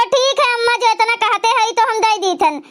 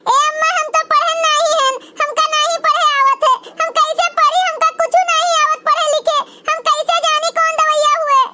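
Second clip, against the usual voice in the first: put-on higher little girl with a laugh at the start; child voice, higher and amused; young girl voice; 5 semitones higher than usual. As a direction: protest she cannot read, half laughing. Same child voice continues.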